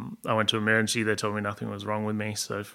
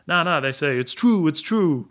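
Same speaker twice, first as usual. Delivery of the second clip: put-on Italian accent; 6 semitones higher, 8 dB louder than usual. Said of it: "he's like".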